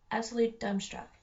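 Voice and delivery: ordinary delivery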